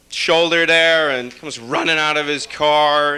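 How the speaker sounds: ordinary talk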